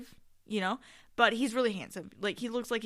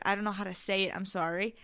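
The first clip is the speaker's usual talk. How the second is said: normal talking voice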